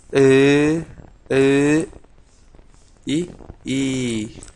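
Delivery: "A, O, O, U, E, E, I" each held long and alone; teacher's drawn-out model of "A", "O", "U", "E", "I"; vowel pronunciation demo. Shown as a demonstration. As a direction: sustain each vowel evenly, from "A" to "I". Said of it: "la pronunciación esta es".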